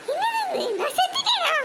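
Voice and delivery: high-pitched startled questions; shocked disbelief. Acted. Old woman finding herself dead, shocked disbelief. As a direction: gasp it out high, aghast.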